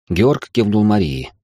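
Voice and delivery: ordinary delivery, nothing marked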